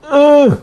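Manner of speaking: "U" throaty and nasal, sharply high; the guttural nasal "U" with the tilde; nasal guttural vowel. Shown as a demonstration. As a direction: squeeze "U" from the throat and nose. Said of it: "Tiene la virgulilla también".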